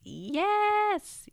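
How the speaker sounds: very high, loud joyful shriek; excited squeal. Real, not acted. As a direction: squeal with real delight at the milestone. Celebrating fourteen hundred listens.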